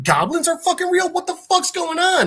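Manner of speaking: high-pitched mock disbelief; incredulous outburst. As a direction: act out a freaked-out reaction, pitch jumping way up. [name] reacts to goblins.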